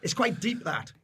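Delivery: normal talking voice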